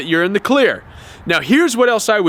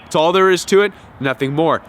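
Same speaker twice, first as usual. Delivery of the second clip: ordinary talk, nothing marked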